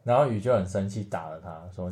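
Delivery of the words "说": ordinary talk, nothing marked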